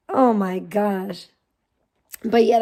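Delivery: usual speaking voice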